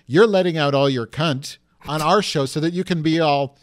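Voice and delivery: ordinary talk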